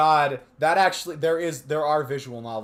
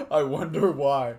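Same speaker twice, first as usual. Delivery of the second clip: laughing; 7 dB louder than usual. Real, not acted. Sarcastic laughing aside.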